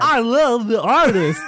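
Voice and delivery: loud, high, drawn-out mock gushing; slow, over-the-top impression of a movie fan; bad impersonation of Andrew. Co-host retrying his impression of the sound engineer, hammed-up and loud. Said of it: "is that close?".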